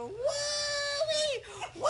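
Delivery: long, high, gleeful whooping. Real, not acted. Patient on laughing gas, high whoops. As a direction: break into drawn-out high whoops. Whooping mid-sentence, asked to quiet.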